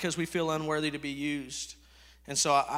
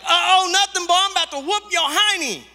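put-on higher, louder scolding of a naughty boy; mock angry mom yell; 12 semitones higher, 10 dB louder than usual. Fed-up mama yelling at her son over broken stuff.

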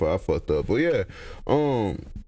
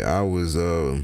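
ordinary talk

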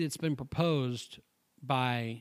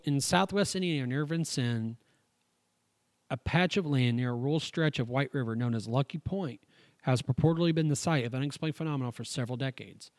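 louder, even reading-aloud voice; 5 dB louder than usual. Acted. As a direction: read the article out like a report. Reads the UFO article aloud.

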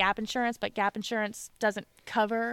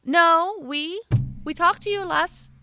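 higher, firm receptionist voice; insistent receptionist; 6 semitones higher, 4 dB louder than usual. Retold or imitated. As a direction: correct the patient, a little louder and sure. Receptionist insists on root canal.

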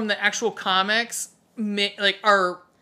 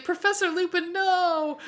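mock dismay; 10 semitones higher than usual. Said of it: "I was like".

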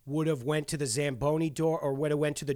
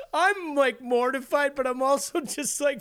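normal talking voice

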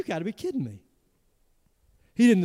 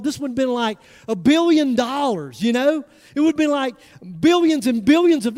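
louder, higher sermon build over the foolish servant; preaching at full force; 5 semitones higher, 6 dB louder than usual. Acted. Preacher rousing the congregation, loud and higher. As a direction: preach it with rising heat.

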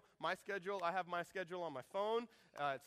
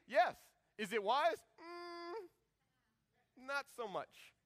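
higher, hesitant wise; rising doubt; 8 semitones higher, 3 dB louder, much slower than usual. Shown as a demonstration. Pastor recalling the football ticket offer, doubtful rising hum. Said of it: "I said".

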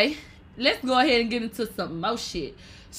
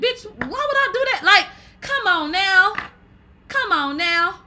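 outraged disbelief; 7 semitones higher, 4 dB louder than usual. Real, not acted. Mock outrage at prank request.

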